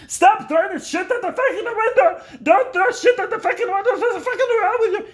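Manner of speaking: high, loud mock yell of an angry dad; hammed-up furious father scolding; dad screaming about the window. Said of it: "He would just come up right away and be like".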